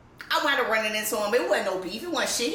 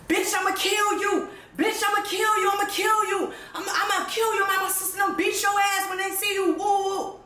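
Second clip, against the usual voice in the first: mock threatening voicemail; 8 semitones higher than usual. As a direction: ham up the ex yelling threats on voicemail. Ex's threatening voicemail retold.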